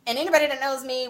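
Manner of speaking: normal talking voice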